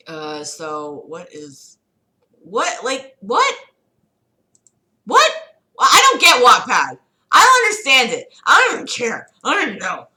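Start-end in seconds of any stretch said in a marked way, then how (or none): 2.4-5.3 high rising disbelief; baffled what. Real, not acted. Baffled by Wattpad. 5.8-10.1 high, loud, fed up; exasperated rant. Real, not acted. Exasperated rant about Wattpad.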